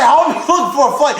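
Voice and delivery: retold scolding. Scolding Trevor for sitting on the couch, exasperated mock rant. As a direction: replay the nagging, fast and high.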